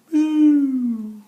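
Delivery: put-on sudden loud playful boo to startle the kids. Reading to children, a loud playful boo. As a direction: pop out a big mock-scary boo.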